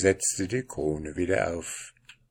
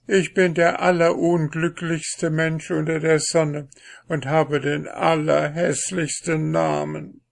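high, pleading unlucky fellow; Pechvogel, lamenting; 7 semitones higher, 4 dB louder than usual. A narrator's reading. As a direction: lament your misery in a raised voice. (klagender Pechvogel, hoch und flehend)

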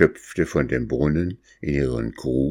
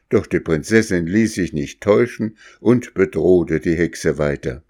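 ordinary delivery, nothing marked

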